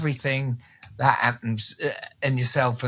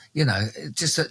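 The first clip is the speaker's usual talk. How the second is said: ordinary delivery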